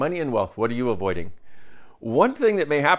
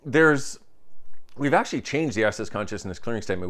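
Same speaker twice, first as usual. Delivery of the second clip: ordinary delivery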